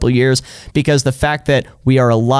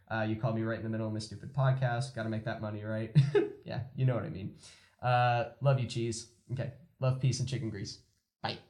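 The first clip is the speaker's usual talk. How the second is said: quiet phone chat; 16 dB quieter, faster than usual. Acted. Low quiet phone talk.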